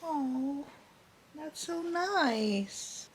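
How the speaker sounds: soft, high, very slow sigh; touched. Real, not acted. Reader moved by a tender surprise, soft and touched. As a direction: melt at a sweet moment. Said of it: "I didn't see that coming".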